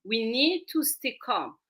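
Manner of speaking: soft, unhurried reminder; calm voice. Acted. Expert to tantrum child while holding hands, soft and calm. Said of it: "I will hold your hand and I say".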